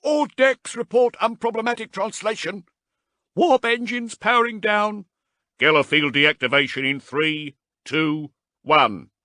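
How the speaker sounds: loud, high mechanical relay; ship vox reports. A narrator's reading. Crew reporting to the bridge over vox, loud and high. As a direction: read out like a ship's intercom.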